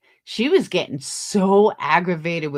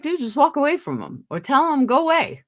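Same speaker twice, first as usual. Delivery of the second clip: high urgent plea; 9 semitones higher than usual. Retold or imitated. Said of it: "I was like".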